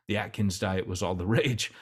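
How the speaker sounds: ordinary talk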